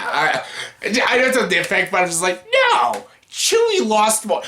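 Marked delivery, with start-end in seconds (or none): 2.5-4.4 mock outrage. Outraged retort to Leia.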